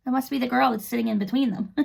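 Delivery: plain reading voice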